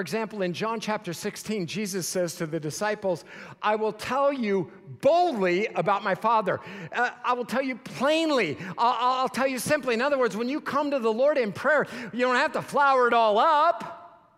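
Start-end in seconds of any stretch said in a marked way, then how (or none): 3.6-14.3 loud, high, insistent sermon voice; emphatic preaching. Acted. Preacher urging listeners to pray plainly, loud and insistent. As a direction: press the point home to the crowd. Preacher quoting Jesus, then exhorting.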